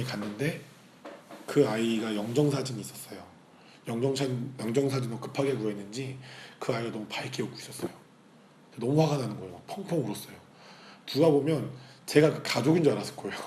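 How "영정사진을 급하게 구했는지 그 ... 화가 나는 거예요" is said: ordinary delivery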